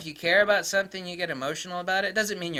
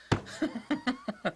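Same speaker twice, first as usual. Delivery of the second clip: amused chuckle; 8 semitones higher, 4 dB quieter than usual. Real, not acted. Laughs at own jab.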